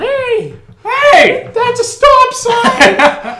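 high, slow laughing mock shout; high mock yell. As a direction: yell it shrill like a scandalised lady. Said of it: "and yelled".

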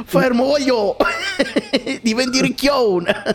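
high shouted Neapolitan mock voice; hammed-up Neapolitan rant; Neapolitan accent. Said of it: "me lo immagino con l'accento napoletano".

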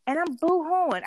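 high, playful wail; comic tearful retelling; mock boo-hooing. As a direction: exaggerate the weepiness for laughs. Mom retells her own crying.

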